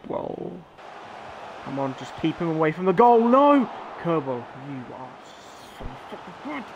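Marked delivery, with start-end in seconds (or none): 3.2-3.7 sharp high loud cry; real alarmed shout; panicked no. Real, not acted. Shot on his goal. 5.8-6.6 much softer, breathed out in disbelief; real quiet amazement at the keeper; hushed awe. Real, not acted. Awed by keeper's save.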